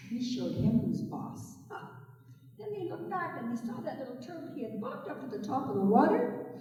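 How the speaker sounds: usual speaking voice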